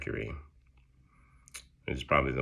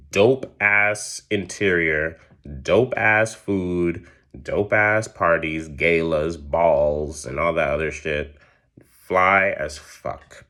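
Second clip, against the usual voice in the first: louder, slow and savouring each item; hyped up; 2 semitones higher, 9 dB louder, slower than usual. Real, not acted. Hyping up the castle's luxury to her viewers, loud and slow. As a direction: relish every luxury like it's the best party ever.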